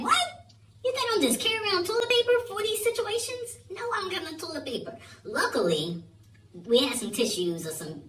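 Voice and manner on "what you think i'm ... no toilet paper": high, fast exasperated disbelief; incredulous. Retold or imitated. Answering his friend in high fast disbelief. Incredulous reply about toilet paper.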